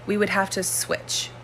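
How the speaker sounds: ordinary talk, nothing marked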